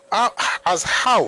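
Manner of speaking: slow, high, drawn-out disbelief; high, slow how, as how; incredulous. Real, not acted. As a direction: stretch it out in mock disbelief. Mocking incredulous repeat.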